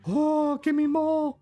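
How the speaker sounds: high excited cry. Real, not acted. Cheering production.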